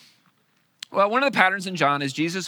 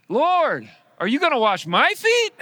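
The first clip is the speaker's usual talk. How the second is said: higher, louder eager question; eager Peter; 11 semitones higher, 8 dB louder than usual. Retold or imitated. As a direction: blurt it out like clueless Peter. Peter voiced eagerly in retelling.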